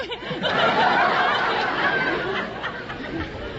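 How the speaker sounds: high loud woo into laughter; excited cheer. Real, not acted. Cheering and laughing after winning money on the show. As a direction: cheer the win.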